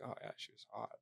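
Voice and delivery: sly whispered aside; husband's hushed confession. Retold or imitated. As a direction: whisper it with a grin.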